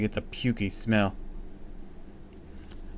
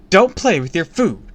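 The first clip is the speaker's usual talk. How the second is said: sharp, loud motherly rebuke, much higher; angry scolding; 9 semitones higher, 10 dB louder than usual. A narrator's reading. Mom scolding son at breakfast, loud and angry. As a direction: bark it at the child across the table. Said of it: "glared at me".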